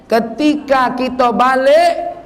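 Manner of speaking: ordinary delivery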